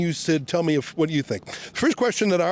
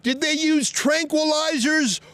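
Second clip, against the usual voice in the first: calling out; 8 semitones higher, slower than usual. Real, not acted. Calling questions to police cars.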